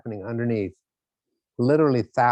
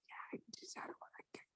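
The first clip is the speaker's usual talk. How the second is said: fake lost voice; 29 dB quieter than usual. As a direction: pretend you've lost your voice. Faking lost voice to boss.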